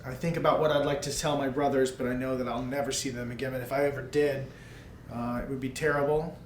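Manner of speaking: ordinary talk, nothing marked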